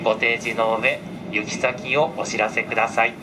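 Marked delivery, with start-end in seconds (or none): none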